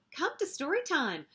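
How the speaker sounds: teacher calling out. A narrator's reading. Teacher summons children to story time, lightly called out. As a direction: call the children over lightly. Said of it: "The teacher called".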